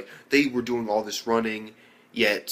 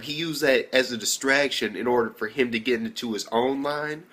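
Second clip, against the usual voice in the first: ordinary delivery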